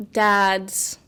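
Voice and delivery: ordinary talk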